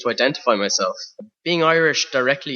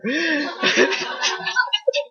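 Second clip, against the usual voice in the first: high laugh. Real, not acted. Laughs at his own joke.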